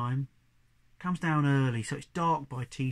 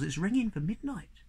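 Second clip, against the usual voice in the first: usual speaking voice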